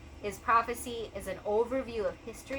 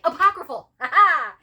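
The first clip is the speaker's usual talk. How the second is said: high, loud gleeful cheer; triumphant; 9 semitones higher, 15 dB louder than usual. Real, not acted. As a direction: crow over finding the word. Triumph at remembering a word.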